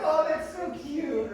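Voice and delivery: high, loud, drawn-out gush; mock cooing. Mimicking women charmed by a flirting friend, mock cooing. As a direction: gush slowly as if utterly charmed.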